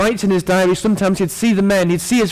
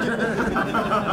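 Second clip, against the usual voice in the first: soft amused laugh; short laugh; 4 semitones higher, 7 dB quieter than usual. Real, not acted. Preacher teasing the couple, soft amused laugh.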